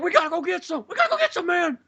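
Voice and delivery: very high, desperate mock pleading; freaking out. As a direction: squeal it like a desperate addict. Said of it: "Just, like, freaking out".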